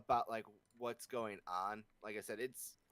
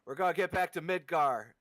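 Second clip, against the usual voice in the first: overly familiar; 5 semitones higher, 8 dB louder than usual. Retold or imitated. Zack voiced to comatose Cloud.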